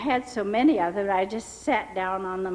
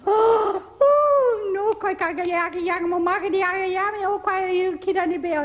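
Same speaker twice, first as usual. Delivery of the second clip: much higher, louder shocked wailing; astonished cry; 11 semitones higher, 6 dB louder than usual. Retold or imitated. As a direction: wail in amazement at her hair. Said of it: "this fellow said".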